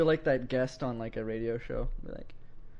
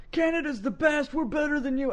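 very high mock chant; high-voiced taunting brag; mock patriotic boasting; 13 semitones higher than usual. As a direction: crow like an obnoxious patriot, pitched way up.

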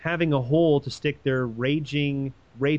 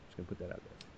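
unvoiced whisper aside; 8 semitones lower, 21 dB quieter, much faster than usual. Real, not acted. Host slipping in a snide remark, whispered. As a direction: breathe it out as a sly aside. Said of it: "whispered aside".

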